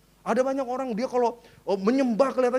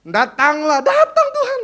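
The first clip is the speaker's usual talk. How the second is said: louder, pitch jumping up into laughter; mock loud prayer cry; 4 semitones higher, 8 dB louder, slower than usual. Pastor mimics fervent Batak prayer, louder and laughing.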